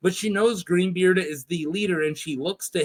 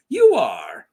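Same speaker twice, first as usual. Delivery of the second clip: gruff shout; 5 semitones lower, 6 dB louder than usual. Acted. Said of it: "he goes".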